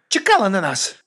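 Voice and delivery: high, indignant outburst; snapped bark. A narrator's reading. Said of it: "гаркнула Батиста".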